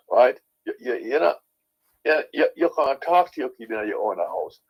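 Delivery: ordinary delivery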